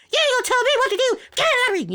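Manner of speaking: very high, fast and loud, full squeal; shrill mock of the ref telling him off; high-pitched referee voice. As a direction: squeak it out like an indignant little referee. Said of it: "very distinct high-pitched voice".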